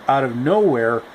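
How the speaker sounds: ordinary delivery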